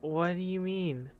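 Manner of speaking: ordinary talk, nothing marked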